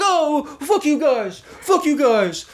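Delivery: high, slow mock holler; mocked angry stranger. Retreating man cursing back at us, mocked high holler. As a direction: drag out a mocking imitation of a sore loser. Imitates retreating man's comeback.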